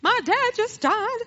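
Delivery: high, slow sobbing voice; crying. Real, not acted. Begging a stranger for thirty cents, sobbing. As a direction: break down, voice wailing high and drawn out. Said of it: "and I'm crying".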